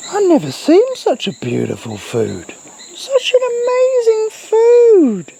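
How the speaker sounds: very high, swooping falsetto over the dog's food; silly squeaky gushing, words stretched out; playful pet-talk voice. Pretend. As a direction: coo absurdly high, dropping and leaping in pitch.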